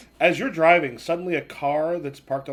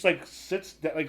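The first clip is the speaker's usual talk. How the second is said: ordinary delivery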